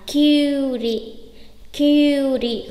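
"Kyuri" with a drawn-out yu; long vowel. Shown as a demonstration. Teacher modelling long vowels, "Kyuri" with u held long. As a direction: hold the u in "Kyuri" before a short ri. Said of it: "Ngân nó kéo dài ra".

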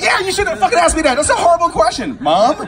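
high, loud exasperated yell; outraged outburst. Retold or imitated. Son to mom over a horrible question, outraged yell. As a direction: explode in comic outrage, voice way up. Acts out scolding his mom.

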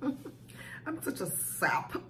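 soft breathy laugh through the words; laughing softly. Real, not acted. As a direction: giggle at yourself, half embarrassed. Laughing at her own tears.

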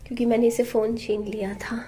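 whispered aside so the sulking son won't hear; hushed whisper, real. Real, not acted. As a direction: drop to a breathy whisper. Explaining son's sulk in a hush.

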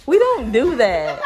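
slow laughing protest; firm denial. Real, not acted. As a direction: set the record straight, laughing. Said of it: "I don't even talk like that".

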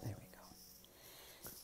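slow, breathy whispered aside. Real, not acted. Vlogger whispering as she paints. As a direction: hush it slowly to yourself.

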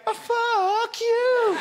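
high, slow, drawn-out sneer; hammed-up slow comeback; mock retort. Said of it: "To which I say".

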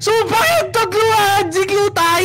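high, drawn-out scream of swearing; real shrieking fright, stretched out; terrified screaming. Real, not acted. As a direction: scream curses after a jump scare. Screams curses after a jump scare.

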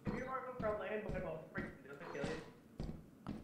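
soft, fast question; barely voiced, hurried question; quiet. Real, not acted. Quiet question mid-game.

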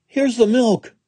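Jack cries it out high and loud; excited shout. A narrator's reading. Jack shouts to his mother, high and loud. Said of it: "shouted Jack".

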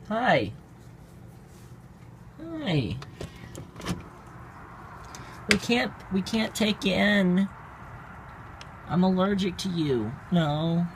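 high, sing-song voice for the cat; high pet-talk voice. Real, not acted. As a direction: coo at the stray cat up high. Talking baby-voiced to stray cat.

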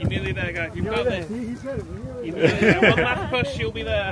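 loud, high encouragement; rally the climbers home; cheering on. Real, not acted.

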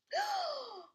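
a sudden high gasp of discovery; sharp high gasp. Acted. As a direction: gasp as the truth hits you. Amelia realises Norman's secret.

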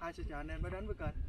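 soft, fast mumbled intro; quiet intro. Shown as a demonstration. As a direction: rush through it under the breath. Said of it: "Thôi, không được đâu".

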